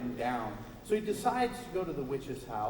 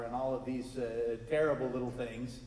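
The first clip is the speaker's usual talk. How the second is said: ordinary delivery, nothing marked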